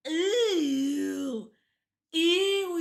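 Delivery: loud, high grossed-out squeal; disgusted ew. Real, not acted. Reacting to disgusting character.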